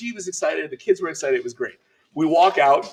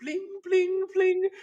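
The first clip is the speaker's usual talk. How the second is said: high, ringing payment chime; ping out each bling high; imitated bling; much slower than usual. Shopper mimicking his taps at every purchase, high chiming blings. Imitating tapping to buy things.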